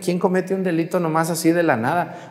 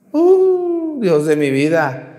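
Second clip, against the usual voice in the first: mock dismay; 6 semitones higher, much slower than usual. Real, not acted. Preacher exclaims about sly old men.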